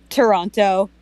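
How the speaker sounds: ordinary talk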